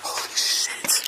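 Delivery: slow, breathless whisper; shocked whisper. Real, not acted. Right after the loud crash.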